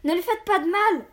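high, loud desperate plea; boy's alarmed cry, pitched right up. A narrator's reading. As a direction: shout to protect your father. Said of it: "Il s'écria".

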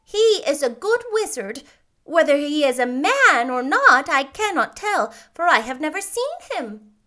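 put-on high, emphatic old lady voice; kindly old witch. A narrator's reading.

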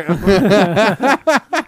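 big burst of laughter; cracking up loud. Real, not acted. Big laugh at horcrux joke.

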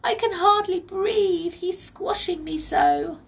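high, plaintive tiny voice, slowing at the end; begging pixie. A narrator's reading. Pixie tells Molly she's squashed, pleading. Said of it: "she begged her".

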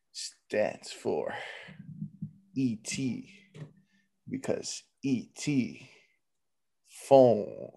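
hushed joke buildup. Pretend. Setting up a crude E T joke, hushed and low. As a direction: murmur the setup like a secret.

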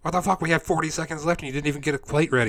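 high, loud mock kitchen rant; hammed-up frantic scolding; screaming. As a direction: berate your teammate at the top of your voice. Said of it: "We scream at each other".

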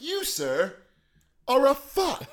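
high, grand mock-formal insult; lordly pompous address; regal voice. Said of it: "as the regal".

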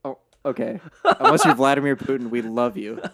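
words through real laughter; laughing. Real, not acted. Laughing at the teasing.

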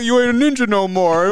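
laughing taunt. Retold or imitated. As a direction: quote it while laughing. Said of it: "the dude was like".

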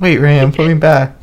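high-pitched playful begging; mock pleading. Podcaster begs friend to put them back on the list, mock pleading.

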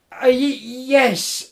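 nervous, halting, tripping on every no; flustered stammer. Acted. Jim nervously denying to the woodsman, stammering. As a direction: fumble for an excuse.

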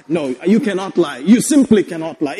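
ordinary talk

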